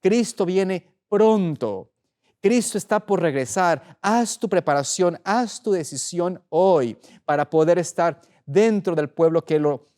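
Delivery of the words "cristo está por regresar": ordinary talk, nothing marked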